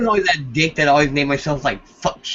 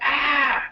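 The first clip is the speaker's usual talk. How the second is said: high mock scream; 14 semitones higher than usual. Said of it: "They're just like".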